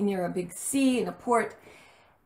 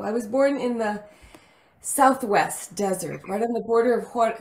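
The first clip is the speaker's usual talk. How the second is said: ordinary talk, nothing marked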